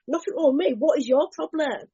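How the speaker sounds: high-pitched, indignant comeback; mock-defensive, pitched well up; instinctive defensive retort. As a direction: act out touchy denial, throwing the blame back. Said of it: "That's my natural response to that question".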